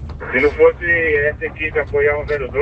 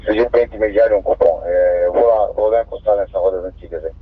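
crackly two-way radio transmission; squelchy handheld radio; police radio; 7 semitones lower, faster than usual. Real, not acted. Unit answers over radio.

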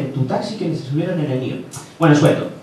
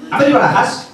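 higher-pitched, emphatic destination; Luis Moya; 4 semitones higher than usual. Acted. Co-driver repeats destination to puzzled driver, high Moya impression. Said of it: "Es Luis Moya".

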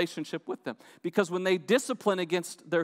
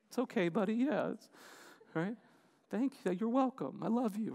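softer, a little higher, tender; melted mom; 4 semitones higher, 8 dB quieter than usual. Retold or imitated. Mom softening as her son thanks her, tender. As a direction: gently reassure the boy like a softened mom. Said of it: "Like, melted".

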